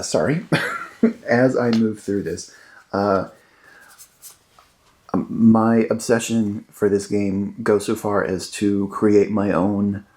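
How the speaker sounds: normal talking voice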